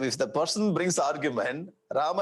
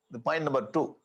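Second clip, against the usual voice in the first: ordinary delivery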